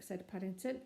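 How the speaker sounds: plain reading voice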